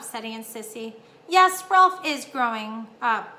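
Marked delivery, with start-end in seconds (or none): 1.3-3.3 slow, drawn-out motherly agreement; wistful. A narrator's reading. Mother agreeing that her son is growing up, wistful. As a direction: agree slowly, with a touch of sadness. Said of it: "with a sad note in her voice".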